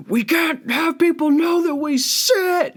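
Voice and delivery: high, slow, far put-on voice; frantic mom. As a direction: shriek it like a panicked mom. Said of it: "that person is going around the house like".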